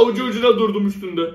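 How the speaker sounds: ordinary talk, nothing marked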